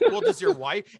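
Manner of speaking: high, loud mocking cop voice; mock officer. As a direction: ham up the cop's question. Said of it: "the officer goes".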